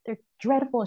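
high mock outrage at Coke; lightly hammed activist refusing to work for Coke; militant. Refusing the Coca-Cola job offer, high hammed-up militant disgust. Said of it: "like, militant".

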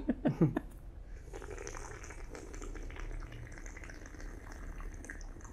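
long noisy slurp of hot tea; loud tea slurp. Real, not acted. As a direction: slurp the tea as loudly as possible. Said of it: "that was the all-time best".

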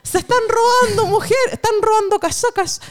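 high, frantic rumour cry; mock panic. Crowd spreading rumours. (pánico fingido, agudo)

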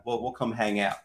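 normal talking voice

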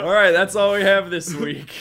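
loud laughing fake sign-off; joking outro through laughter. Real, not acted.

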